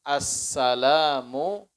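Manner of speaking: Assalamu alaikum very slow and drawn out; correct salam. Shown as a demonstration. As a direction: recite the proper full salam slowly. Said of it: "Itu salamnya".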